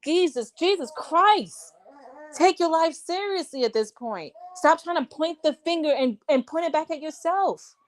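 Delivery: fed-up preaching at him; exasperated scolding. Real, not acted. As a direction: lecture him with real exasperation. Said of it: "I didn't mean to curse".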